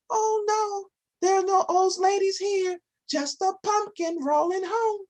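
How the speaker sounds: high, fibbing storybook granny; sweet old lady voice. Acted. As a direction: play the sly granny pretending.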